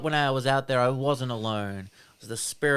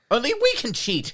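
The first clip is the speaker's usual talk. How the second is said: 1 indignant villain; 10 semitones higher, 4 dB louder than usual. Retold or imitated. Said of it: "He goes".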